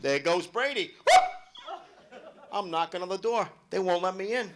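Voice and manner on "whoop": loud high whoop. Retold or imitated. Comic reenacts whooping at a play. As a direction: cheer the touchdown.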